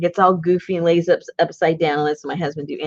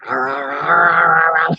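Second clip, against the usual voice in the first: monster growl; slower than usual. Retold or imitated. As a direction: growl like a grumpy little dog. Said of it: "he goes like that".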